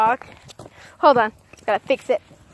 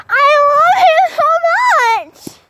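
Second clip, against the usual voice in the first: high, loud gushing squeal; 14 semitones higher, 5 dB louder, slower than usual. Real, not acted. Telling viewers about her horse, high loud gushing squeal.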